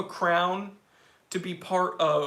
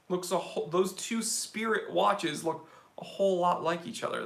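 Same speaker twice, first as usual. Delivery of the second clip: ordinary delivery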